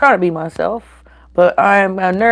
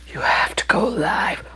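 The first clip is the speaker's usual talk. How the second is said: quoted whisper; 3 semitones higher, 5 dB quieter than usual. Retold or imitated. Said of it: "You were like".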